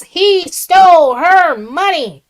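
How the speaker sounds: loud, slow, high shouted read-out; reading a viewer's all-caps comment as a slow shout. Retold or imitated. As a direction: belt the line out like it's in all caps. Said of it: "said in all caps".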